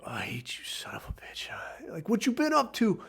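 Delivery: hushed, breathless whisper; a whispered mock confession of hate; whispered aside. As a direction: whisper it like a secret grudge. Private aside about the friend.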